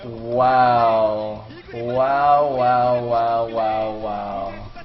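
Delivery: low, drawn-out repeated amazement; stunned, slow wonder; awed. Real, not acted. Caster in awe after the upset.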